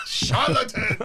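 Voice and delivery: high pompous accusation through laughter; mock haughty cry. Laughing friend repeats patient's charge, haughty and high. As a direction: fling the word like a lord.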